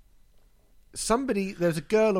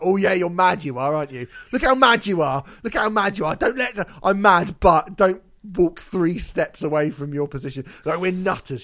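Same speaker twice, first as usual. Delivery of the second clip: loud, higher, sneering mock praise; sarcastic mockery; 5 semitones higher, 7 dB louder than usual. Mocking the receptionist who claims she's mad, loud sarcastic jeering. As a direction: lay the sarcasm on thick, loudly.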